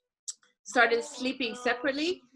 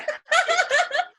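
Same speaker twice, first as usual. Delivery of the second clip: laugh; 6 dB louder than usual. Real, not acted. Laughs after ancestors quote.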